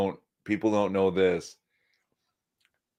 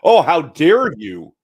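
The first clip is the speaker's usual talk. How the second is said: mock outrage; 9 semitones higher, 10 dB louder than usual. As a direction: act out a hammed-up offended ally. Mock outrage from offended ally.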